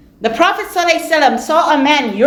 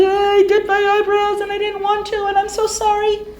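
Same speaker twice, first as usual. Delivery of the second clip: mock tearful confession; 7 semitones higher than usual. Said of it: "She starts to cry".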